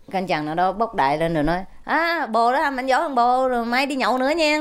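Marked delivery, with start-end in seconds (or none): 1.8-4.6 buddy on phone. Retold or imitated. Soldier mistakes general for buddy.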